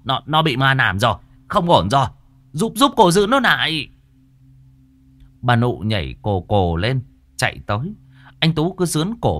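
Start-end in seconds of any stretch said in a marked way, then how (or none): 0.0-3.9 louder, high urgent call for help; urgent alarm. Acted. Healer pinning the son, calling the mother for help. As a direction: shout for help while struggling. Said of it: "Cô An tái mặt".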